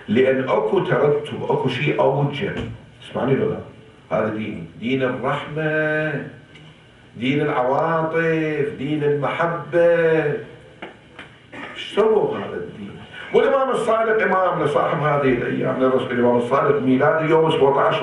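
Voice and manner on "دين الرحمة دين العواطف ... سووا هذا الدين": ordinary talk, nothing marked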